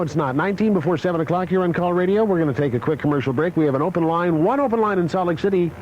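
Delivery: usual speaking voice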